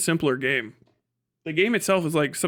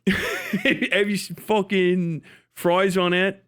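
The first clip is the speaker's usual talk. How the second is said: Philly accent; 4 semitones higher, much slower than usual. Shown as a demonstration. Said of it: "This is a Philly people off".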